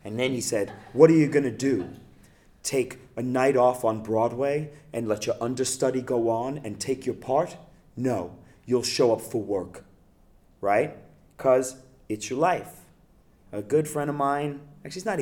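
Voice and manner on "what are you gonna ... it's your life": theatrical teacher voice. Retold or imitated. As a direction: lecture them like a grand stage actor. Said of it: "theatrical voice".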